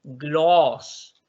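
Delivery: "Gloss" slowly, vowel drawn out; long vowel. Shown as a demonstration. Teacher repeating the second word, long-vowel "Gloss". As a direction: stretch the middle of "Gloss". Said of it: "sino que es gloss".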